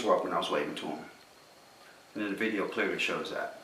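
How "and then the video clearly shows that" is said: ordinary talk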